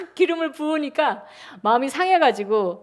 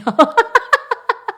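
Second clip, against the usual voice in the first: high, loud giggle; high laugh; 11 semitones higher, 5 dB louder than usual. Real, not acted. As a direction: laugh at the burden. Laughs about cooking meals.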